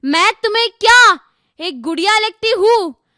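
high, declaimed rhetorical question; challenging question. Acted. Girl questioning the world about being a doll, high challenge. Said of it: "Neetu ने यही प्रश्न पूछी".

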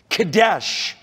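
Kadesh said slowly as kuh-DESH, stress on DESH; Hebrew word Kadesh. Shown as a demonstration. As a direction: pastor teaching the Hebrew word Kadesh, carefully. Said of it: "is, is the Hebrew".

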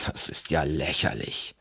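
low, soft growl of contempt. A narrator's reading. Olaf dismissing Kandamir's accusation, low growl. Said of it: "knurrte Olaf".

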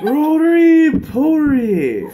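loud drawn-out call. Retold or imitated. Quoting comedian Bobby Lee.

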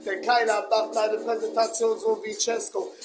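ordinary talk

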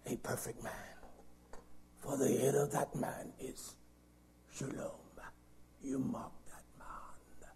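soft unvoiced reading; whispered scripture. Preacher murmuring scripture to listeners, whispered. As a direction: hush the verse to them.

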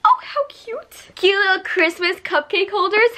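high, slow cooing; cooing over gifts. Real, not acted. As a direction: gush at something cute. Opening gifts.

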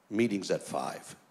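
low, quiet, slow dismissal; curt dismissal. Retold or imitated. Elder walking away from young preacher, low curt dismissal. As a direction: drop the voice and dismiss him.

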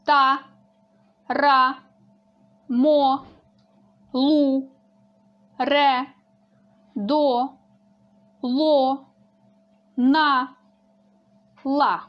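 crisp, loud articulation demo for the class; clearly spoken syllables. Shown as a demonstration. Teacher demonstrating syllables loudly and clearly for pupils. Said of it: "уважно дивимося на слайд і слухаємо".

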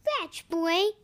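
loud call to a dog; shouting. A narrator's reading. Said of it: "he shouted".

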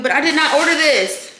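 high, loud indignant protest; complain, fed up; high, loud irritated complaint; annoyed. Real, not acted. Annoyed at wrong item.

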